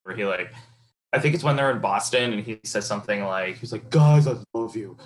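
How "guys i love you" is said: a lightly put-on earnest teen voice, a bit louder; mild mock of an earnest young actor; Emery Cohen impression. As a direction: play the moody son gushing. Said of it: "that's a pretty good Emery Cohen".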